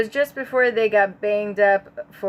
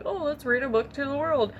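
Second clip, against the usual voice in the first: mock cheerful voice; 7 semitones higher, 4 dB quieter than usual. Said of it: "you're like".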